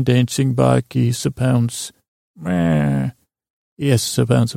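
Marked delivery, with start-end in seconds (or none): 2.4-3.1 whispered low meh; a low breathy creature noise. Acted. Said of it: "do the meh".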